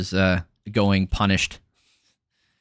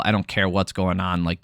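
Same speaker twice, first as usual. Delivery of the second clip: ordinary delivery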